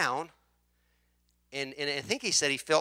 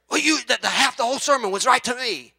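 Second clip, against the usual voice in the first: mock complaining churchgoer, higher and louder; imitated congregant; 6 semitones higher, 6 dB louder than usual. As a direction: whine like he's been singled out.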